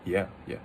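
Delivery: "yeah" low, soft and offhand; casual third tone. Shown as a demonstration. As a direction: drop "yeah" down without rising. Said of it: "saying a third tone".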